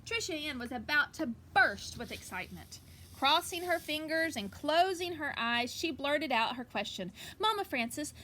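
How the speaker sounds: lively picture book reading; story reading. Acted. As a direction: read it out to the kids with energy. Reads picture book aloud.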